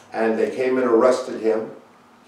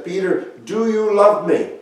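higher, louder searching question; Jesus pleading with Peter, raised and urgent; earnest plea; 7 semitones higher, 6 dB louder than usual. Acted. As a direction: lift the voice and press the question.

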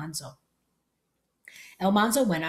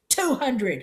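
a raised shout, higher and louder; 6 semitones higher, 4 dB louder than usual. Acted. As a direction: bark "Two hundred" as a flat demand. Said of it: "he shouted".

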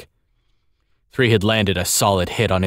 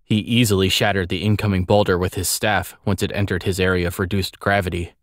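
plain reading voice

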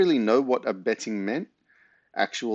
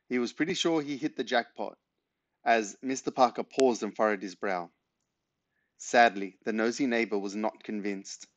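ordinary delivery

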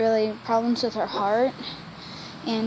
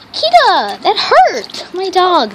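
high loud yelp at a friend; loud complaint; 7 semitones higher, 7 dB louder, much slower than usual. Real, not acted. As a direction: shout it out in pain. Friend hurts her in pool.

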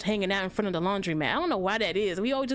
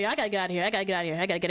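ordinary talk, nothing marked

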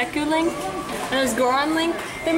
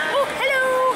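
squealy greeting. Real, not acted. Greeting something cute.

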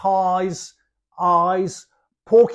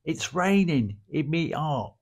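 soft, dropped-G Cockney line; Cockney accent; 3 semitones lower, 4 dB quieter than usual. Shown as a demonstration. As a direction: say it like an East London Cockney. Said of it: "I could say".

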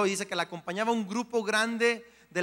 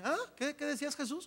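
higher, casual, just-woken voice; dead man waking; 8 semitones higher, 5 dB quieter than usual. Retold or imitated. The dead young man answers Jesus, higher and drowsy. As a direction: answer like you just woke up. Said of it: "el muerto dice".

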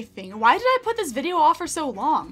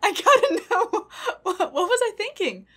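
high voice cracking up with laughter; laughing almost to tears in disbelief; helpless laughter; 9 semitones higher, 3 dB louder than usual. Real, not acted. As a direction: wail the words through hard laughing. Cracks up over own blunder.

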